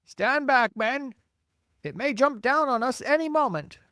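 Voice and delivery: very high, loud warning; yelled warning. A narrator's reading. Police chief warning his men it may jump, yelling. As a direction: yell urgently to move them back. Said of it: "yelled the chief of police".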